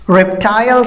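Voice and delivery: reptile ending in a long "tile"; British reptile with an I sound; British pronunciation. Shown as a demonstration. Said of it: "same the case".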